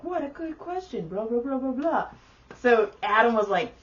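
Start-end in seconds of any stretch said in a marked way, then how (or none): 0.0-2.1 higher, patronizing trainer voice; imitated chirpy presenter patter; gushing trainer. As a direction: praise a child, then rattle on emptily. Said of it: "the trainer's like".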